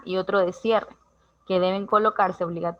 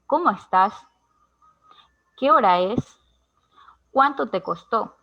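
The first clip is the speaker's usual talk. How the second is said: louder, clearly questioning read of each example; asking questions; 5 dB louder than usual. Shown as a demonstration. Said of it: "Signos de interrogación".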